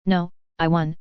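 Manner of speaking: ordinary delivery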